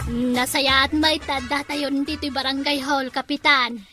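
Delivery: usual speaking voice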